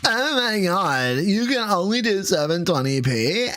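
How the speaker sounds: mock him in a high sneering voice; mocking whine. Mocking co-host's complaint.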